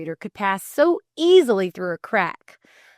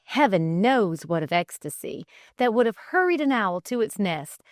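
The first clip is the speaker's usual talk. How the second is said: ordinary delivery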